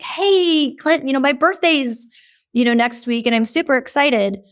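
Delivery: bright, high excited announcement of good news; excited sharing. Retold or imitated. Friend sharing birthday news, excited and high. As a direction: burst in with your happy birthday news. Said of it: "So if I'm excited".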